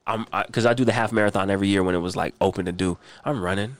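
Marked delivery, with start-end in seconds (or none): none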